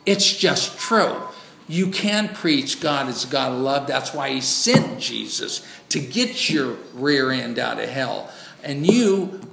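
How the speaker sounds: fiery preaching. Acted. Preacher scolding mega church teachers, loud and forceful. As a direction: hammer the truth home to the congregation.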